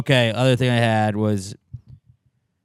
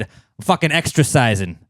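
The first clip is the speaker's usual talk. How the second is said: gruff guy voice; 4 semitones higher, 6 dB louder than usual. Retold or imitated. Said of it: "but he said".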